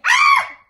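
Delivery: very high, loud fright scream. A narrator's reading. Elmore waking from a bad dream, screaming. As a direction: scream from a nightmare. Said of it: "Elmore screamed".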